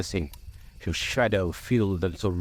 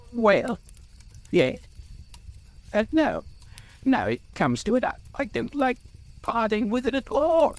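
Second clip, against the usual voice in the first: high, halting hobbit turning sharp; suspicious and annoyed; 11 semitones higher than usual. A narrator's reading. Bilbo balks at parting with the ring, suspicious. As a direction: start hesitant, grow sharply suspicious, pitched high. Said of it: "It was sharp with suspicion and annoyance".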